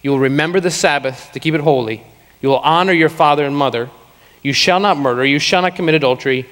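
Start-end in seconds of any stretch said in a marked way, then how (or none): none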